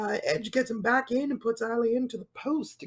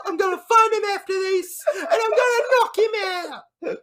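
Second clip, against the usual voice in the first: loud, high mock tough-guy shout; hammed-up fighting-talk promo; Vinny Jones; 5 semitones higher, 5 dB louder than usual. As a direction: belt out the threats in caricature. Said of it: "Vinny says".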